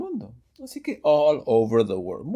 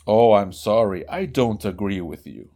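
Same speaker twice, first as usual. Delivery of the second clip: lower, different voice for the second speaker; second of two voices; 5 semitones lower, 4 dB louder than usual. Acted. Teacher voices the one who disagrees, lower and louder. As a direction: answer back in a lower second voice. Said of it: "la conversación con dos voces".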